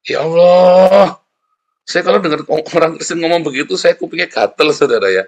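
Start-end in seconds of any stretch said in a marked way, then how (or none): 0.1-1.2 slow, loud, drawn-out "Ya Allah" with a long sighing "Allah"; mock pious exclamation. Preacher mimicking Christians who say it, slow loud exaggerated sigh. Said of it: "Siapa yang suka bilang gitu?".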